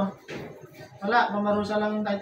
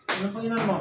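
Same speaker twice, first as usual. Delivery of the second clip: ordinary talk, nothing marked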